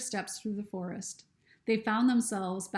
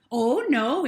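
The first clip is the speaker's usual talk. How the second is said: louder urgent interruption; interrupting; 4 semitones higher, 9 dB louder than usual. Acted. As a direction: jump in loudly to stop her. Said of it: "interrupted Bird".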